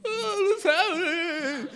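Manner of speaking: high, slow, wailing question; mock crying panic. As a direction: whimper it like a scared kid. Greg panicking in sliding car.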